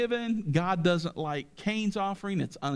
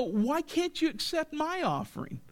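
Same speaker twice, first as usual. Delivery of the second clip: higher, aggrieved whine; Cain sulking at God; 7 semitones higher than usual. Retold or imitated. Cain protests to God about his offering, higher and aggrieved. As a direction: complain like a wounded, resentful son.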